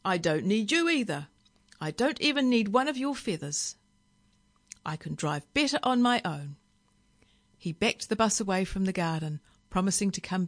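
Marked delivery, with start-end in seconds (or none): 0.0-6.6 high loud retort, easing as it goes; yelling back. A narrator's reading. Driver standing up to the parrot, yelling back. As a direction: open with an indignant yell, then settle into defiance. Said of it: "Billy Castor yelled back".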